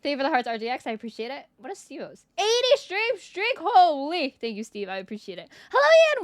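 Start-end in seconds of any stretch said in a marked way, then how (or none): 2.4-4.4 slow, high-pitched disbelief; amazed. Real, not acted. Amazed at viewer's streak. 5.7-6.2 high, loud happy hello; excited greeting. Real, not acted. Excited greeting to chat viewer.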